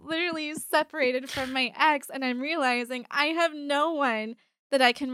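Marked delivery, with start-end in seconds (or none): 0.0-2.1 high laugh spilling into words. Real, not acted. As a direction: laugh then talk through it. Laughing about having nobody.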